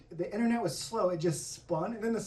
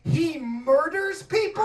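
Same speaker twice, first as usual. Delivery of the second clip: mock outrage; 9 semitones higher, 6 dB louder, slower than usual. Deadpan reveal of lyrics.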